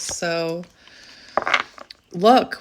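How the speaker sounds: ordinary talk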